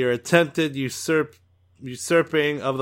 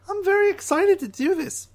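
excited alien Trixel; 4 dB louder than usual. Acted. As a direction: greet him like an overeager little alien. Drawl eager for job.

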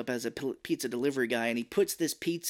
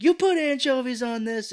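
much higher, louder disbelief; outraged; 12 semitones higher, 8 dB louder than usual. Retold or imitated. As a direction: shout at the pizza place. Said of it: "He's like".

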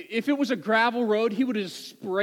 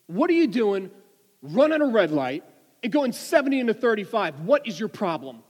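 loud, higher-pitched mock berating; angry scolding; 3 semitones higher, 5 dB louder than usual. Trooper berating driver for running a red light, angry. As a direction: play an exasperated officer telling someone off.

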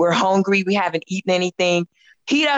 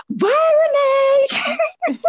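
goodbye call. Retold or imitated. Calling goodbye to star.

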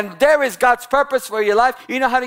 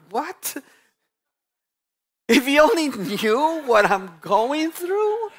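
mock disbelief; 6 semitones higher, slower than usual. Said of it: "mocking the complaint back".

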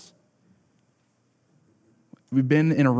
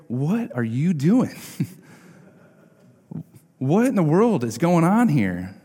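higher, hammed-up annoyed host; mock what-are-you-doing outrage, higher; mock exasperation; 5 semitones higher than usual. What Jesus does not say.

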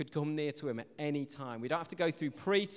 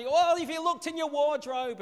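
raised, louder mock parent call; shouting down; 11 semitones higher, 7 dB louder than usual. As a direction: call across the house as an exasperated parent. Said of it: "there's that shouting down".

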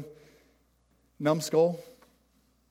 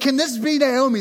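excited exclaim; 7 semitones higher, 7 dB louder than usual. Retold or imitated. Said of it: "the women exclaimed".

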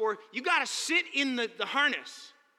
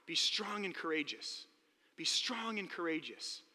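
softer, lower, slow comfort; tender reassurance; 3 semitones lower, 3 dB quieter, slower than usual. Acted. God gently comforting a scared Joshua, soft and slow. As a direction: speak it gently to a frightened child. Tender reframing of God's words.

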